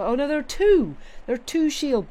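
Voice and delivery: sudden high "oh no" on spotting bugs; alarmed surprise. Real, not acted. Streamer spotting a second bug, alarmed. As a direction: gasp it out, alarmed. Spots bugs in her room.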